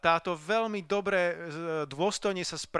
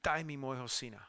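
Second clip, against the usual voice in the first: whispered prayer; 3 semitones lower, 8 dB quieter than usual. Retold or imitated. Interpreter echoes whispered prayer.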